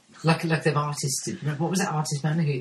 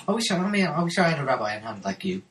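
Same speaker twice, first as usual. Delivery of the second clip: usual speaking voice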